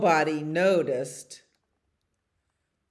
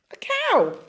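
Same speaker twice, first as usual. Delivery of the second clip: high, loud exclamation; high squeal of delight; excited discovery; 5 dB louder than usual. Acted. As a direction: cry out as if spotting a prize. Dog spots supposed cow.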